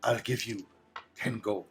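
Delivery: low, soft haggling offer; quiet bargaining lady; lady's offer. Acted. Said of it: "She says".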